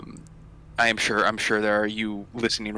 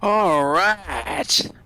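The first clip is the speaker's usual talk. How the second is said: southern accent; 8 semitones higher, 3 dB louder than usual. Real, not acted. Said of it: "no, I did not mean to go".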